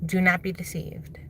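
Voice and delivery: hushed whispered warning; whispering. Real, not acted. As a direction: drop to a whisper to warn. Solemn warning to viewers.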